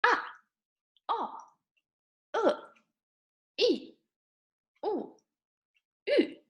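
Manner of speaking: "Ah, o, e, u, ü" each short, falling steeply from high; fourth tone. Shown as a demonstration. Teacher drills fourth tone, each vowel falling. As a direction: drop each vowel sharply from a high start. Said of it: "fourth tone goes down".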